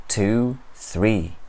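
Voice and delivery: usual speaking voice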